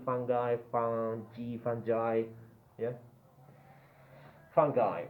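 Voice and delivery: usual speaking voice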